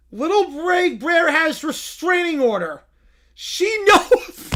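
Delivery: proud, simple creature talk; bear voice. Acted.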